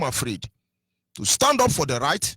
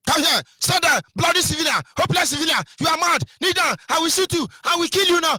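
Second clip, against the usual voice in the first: bullying soldier; 9 semitones higher than usual. Retold or imitated. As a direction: shout down at him like a brutal soldier. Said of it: "I'm not talking about the blockhouse".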